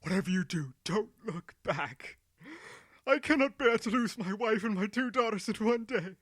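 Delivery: tearful urging. Acted. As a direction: beg through tears, building. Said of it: "urging them over and over again through hot tears".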